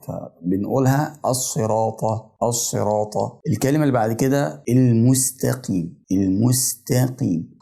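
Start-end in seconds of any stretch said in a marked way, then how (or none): 1.2-3.1 "الصراط" slowly, with thick sad and taa; "الصراط" recited carefully, emphatic letters full. Shown as a demonstration. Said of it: "فبتظهره قوي". 4.7-7.6 slow "المستقيم", thin s and light te; "المستقيم" recited slowly with seen and taa kept light. Shown as a demonstration. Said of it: "حرف السين هنا ده برضو من المواضع".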